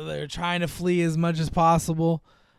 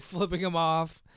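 normal talking voice

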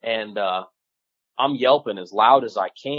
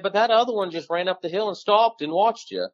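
quoting Caleb a lot higher, half amused; buddy's raised voice; 9 semitones higher than usual. Retold or imitated. Retelling a hunting buddy's words to friends, raised pitch. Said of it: "He said".

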